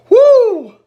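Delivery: loud, high church-style whoop. Real, not acted. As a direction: whoop out mid-prayer. Whooping during prayer over burn.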